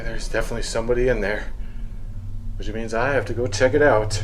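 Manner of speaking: hushed. Real, not acted. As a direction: keep it down so nobody hears. Hushed after hearing someone.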